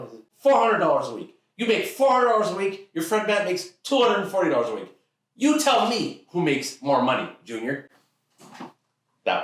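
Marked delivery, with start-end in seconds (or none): 0.4-7.9 high, emphatic, worked-up father tirade; triumphant dad. Acted. Dad proving farmer logic to Junior, worked-up. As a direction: hammer the figures home in exasperation.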